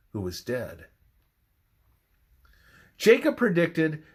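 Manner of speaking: normal talking voice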